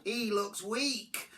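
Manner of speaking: mildly disgusted mother; grumbled. A narrator's reading. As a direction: drag it out in complaint. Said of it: "she grumbled".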